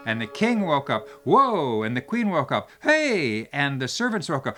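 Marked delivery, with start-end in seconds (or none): 1.2-1.8 king's whoa. Acted. King wakes up. 2.8-3.5 queen's hey. Acted. Queen wakes up.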